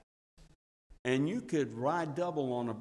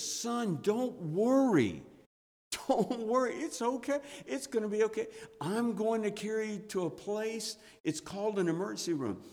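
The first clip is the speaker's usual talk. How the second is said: reassuring father; 8 semitones higher, 3 dB louder than usual. Retold or imitated. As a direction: coax a hurt child calmly. Father comforting injured five-year-old.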